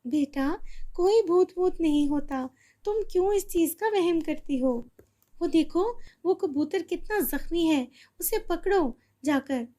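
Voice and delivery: put-on calm the child down; mother calming her scared daughter; reassuring mother. Mother calms, redirects.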